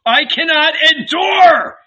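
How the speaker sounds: building to a high loud shout of despair; the hermit cries out to God, breaking; desperate crying out. A narrator's reading. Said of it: "as he cried out".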